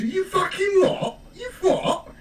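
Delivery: high, slow British "You what?"; incredulous British lad; British accent. Retold or imitated. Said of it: "as Nino says".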